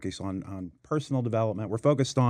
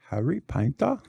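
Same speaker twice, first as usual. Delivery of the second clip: badly faked British accent; 3 semitones higher than usual. Guest joking on his own name, bad British accent. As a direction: try a hammy British accent and miss. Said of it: "with a British accent".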